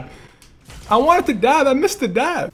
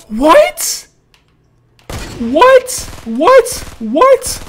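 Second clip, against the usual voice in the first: high, loud, rising yelp; shocked disbelief; 7 semitones higher, 4 dB louder, much slower than usual. Real, not acted. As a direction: exclaim it in stunned disbelief. Shocked by failed sniper shot.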